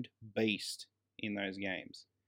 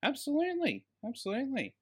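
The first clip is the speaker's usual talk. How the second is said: gushing praise; 11 semitones higher than usual. Real, not acted. Gushing over the actress.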